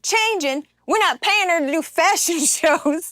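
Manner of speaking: high, indignant mock scoff; hammed-up outraged boss; Baby Billy outrage. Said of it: "he said".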